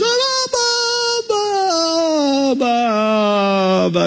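drawn-out high wail sliding steadily down; child's cry fading. Retold or imitated. Girl halfway back across the pool, cry winding down. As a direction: start as a shrill child, stretch and sink to normal. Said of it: "she comes the other way and she's".

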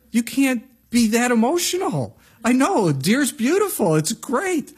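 high, loud exasperated protest; worked-up, pleading complaint; mock exasperation. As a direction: act out an overwrought husband scolding. Exasperated husband scolding in story.

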